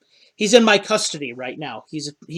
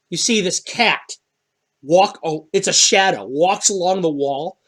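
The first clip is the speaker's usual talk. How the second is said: normal talking voice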